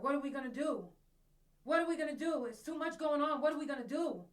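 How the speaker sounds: loud, high, frantic man asking what to do; panicked man's voice. Retold or imitated. Vlogger imitating a panicked man's voice leaking from her phone, loud and high. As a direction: mimic a distraught stranger on the phone, loud and high. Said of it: "another voice came through, a man's voice".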